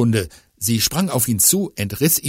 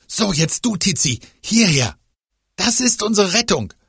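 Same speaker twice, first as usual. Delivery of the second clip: hoch, eifriger Jungenton; aufgeregter Junge; 9 semitones higher than usual. Acted. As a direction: ein Kind treibt die Freundin an. Fiete als Kinderfigur. (aufgeregter Jungenton)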